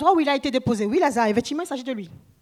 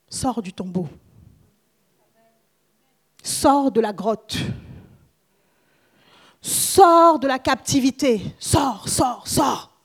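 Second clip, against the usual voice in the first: commanding; slower than usual. Acted. Commanding call to come out.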